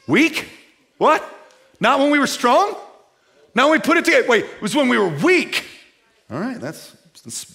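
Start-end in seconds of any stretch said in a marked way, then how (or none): 0.1-5.6 high, loud, disbelieving questions; mock surprise. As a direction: act stunned by the verse, voice up high.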